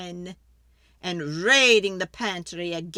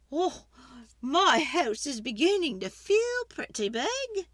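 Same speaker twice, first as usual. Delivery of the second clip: put-on fretful old lady; 7 semitones higher than usual. Old lady begging the wise man for help, high and pleading.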